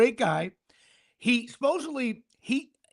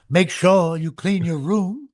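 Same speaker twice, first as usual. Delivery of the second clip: slow, announcer-style order, lightly voiced; announcer parenting; 5 semitones lower, 5 dB louder, slower than usual. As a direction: read a chore like a stadium call. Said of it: "it should be".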